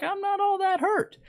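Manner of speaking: very high little falsetto; mock enemy voice. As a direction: squeak it as the unbothered enemy. Mimics the healthy enemy team.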